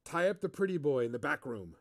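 thin, raspy voice, yellow sung high. Acted. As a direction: rasp it out thinly. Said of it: "in a normal but very thin, raspy voice".

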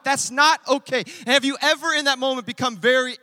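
loud, driving sermon voice; preaching. Acted. Pastor preaching hard to the congregation, loud. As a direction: preach it out to the whole room.

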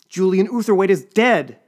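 high, loud, slow wail. Acted. Assistant wails news of colleague's death, high loud wail. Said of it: "she wailed".

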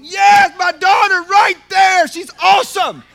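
loud, high, proud shout; proud cheering dad. Acted. God cheering on his daughter from the front row, loud. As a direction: shout it like a dad bursting with pride.

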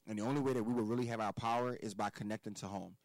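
usual speaking voice

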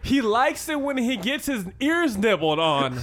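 high, laughing imitation of a yell; mock yelling. Teasing his friend for announcing the secret, mock yelling. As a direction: mimic a friend blurting it across the room. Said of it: "Oh, no, I screamed it".

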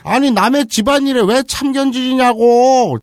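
slow, furious high yelling; angry shout. Acted. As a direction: bellow at the nosy aliens. 아버지 역 외침. (화난 아버지의 느린 고함)

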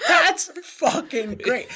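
high, slow, through helpless laughter; laughing delight. Retold or imitated. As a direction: crack up while saying it. Laughing at remembered trailer line.

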